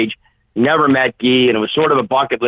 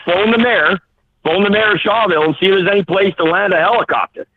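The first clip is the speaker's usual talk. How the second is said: excited; 6 semitones higher than usual. Retold or imitated. Urgent instructions to excited caller.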